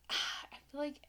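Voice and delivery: half-whispered, tentative recall; hushed guessing. Real, not acted. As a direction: say it softly, almost under your breath. Hesitantly guessing the kid's crude line.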